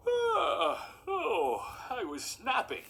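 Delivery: mock yawn stretched over the words. Caught napping man answers the children, yawning. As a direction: talk through a stage yawn. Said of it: "He yawned".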